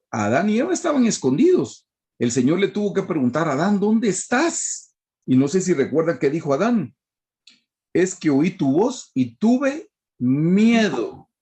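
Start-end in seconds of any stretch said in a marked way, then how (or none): 3.5-4.9 slow, higher voice of God calling out. Acted. Preacher voicing God searching the garden, slow and high. 7.9-11.3 slow, higher, timid confession; frightened Adam. Acted. Preacher voicing Adam hiding from God, slow and timid.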